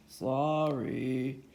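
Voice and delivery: low, soft monster apology; a big monster saying sorry meekly. Acted.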